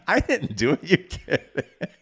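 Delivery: high, loud and laughing. Real, not acted. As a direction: tease through laughter. Teasing back and forth, laughing.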